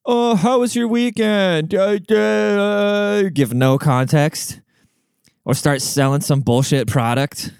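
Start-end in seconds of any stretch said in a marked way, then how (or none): 0.0-3.2 high, slow, vapid chirp; mocking podcast host. Mocking lazy podcast hosts' openers, high slow and vapid. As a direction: put on a much higher, slowed, empty host voice. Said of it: "the first thing they're gonna do is go".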